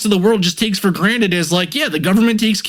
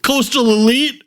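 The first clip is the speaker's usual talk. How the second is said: mocking anti-expert guy; 4 semitones higher than usual. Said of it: "people are like".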